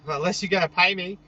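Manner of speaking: usual speaking voice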